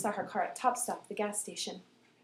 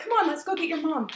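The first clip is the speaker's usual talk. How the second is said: urgent friend; 7 semitones higher, 4 dB louder than usual. Retold or imitated. Friend urging her along.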